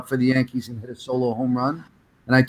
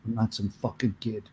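lower, stern man-to-man voice; low firm demand; 5 semitones lower than usual. Retold or imitated. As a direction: put your foot down, low and serious. Fan confronting Reggie firmly.

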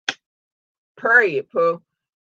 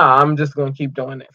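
ordinary talk

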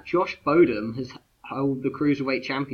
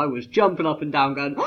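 ordinary delivery

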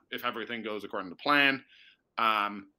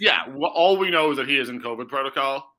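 louder, higher formal reporter read; professional reporter voice; 4 semitones higher, 8 dB louder than usual. Pretend. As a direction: sound like a TV reporter breaking news. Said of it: "professional reporter voice".

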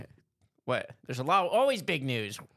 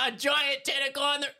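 alarmed pilot; 13 semitones higher than usual. Retold or imitated. Pilot spots a tentacle mid-call.

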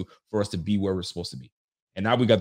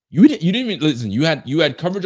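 scolding; 5 semitones higher, 8 dB louder than usual. Real, not acted. Scolding the player.

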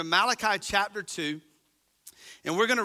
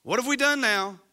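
higher mock-innocent questioning; sarcastic questioning; 4 semitones higher, 3 dB louder than usual. Israelites questioning God, mock-innocent. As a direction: toss the question back sarcastically. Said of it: "They ask".

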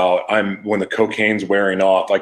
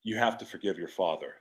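quiet inner voice; 2 semitones lower, 14 dB quieter than usual. Retold or imitated. God's answer, quiet.

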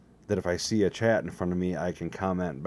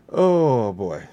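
exasperated; 5 semitones higher, 9 dB louder than usual. Real, not acted. Exclamation after laugh.

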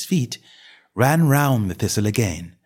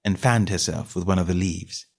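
ordinary delivery

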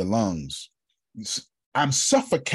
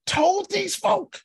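high, slow shouted proclamation; preacher's sudden high shout; preaching shout; slower than usual. Real, not acted. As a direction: belt it out slow and high from the pulpit.

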